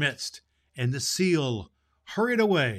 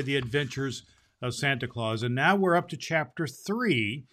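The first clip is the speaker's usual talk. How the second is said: ordinary delivery, nothing marked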